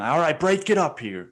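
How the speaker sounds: loud, high bark to split up a fight; mock break-it-up shout. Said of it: "but I would just fucking kindly".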